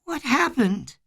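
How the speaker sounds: put-on high, frail little-boy voice; small, high, wavering voice; weak, trembling. A narrator's reading. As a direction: ask it weakly, dazed and hurt. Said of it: "in a weak, trembling voice".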